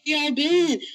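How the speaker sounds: mock wail from impatient listeners; mock-whiny listeners. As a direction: imitate needy fans, drawing the words out slowly. Imitating complaining listeners in chat.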